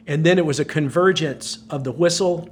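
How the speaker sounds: usual speaking voice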